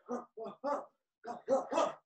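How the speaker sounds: ordinary delivery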